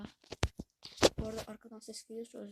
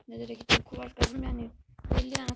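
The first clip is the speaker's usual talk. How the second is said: louder emphatic repeat; 10 dB louder than usual. Real, not acted. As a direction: say it again, louder.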